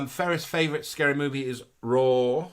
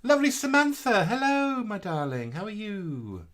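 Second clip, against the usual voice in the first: sing-song greeting; 8 semitones higher than usual. Acted. High greeting to viewer.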